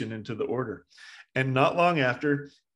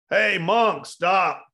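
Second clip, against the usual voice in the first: loud, higher acted shout; calling out; 7 semitones higher, 6 dB louder, much slower than usual. Murderer calling after the monk on the path, shouting. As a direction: holler after someone walking away.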